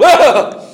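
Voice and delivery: high, loud imitated shriek of fright; startled yelp. Boy in the canoe shrieking at dad's fake snake warning. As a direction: yelp like a scared kid. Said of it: "just to see me go".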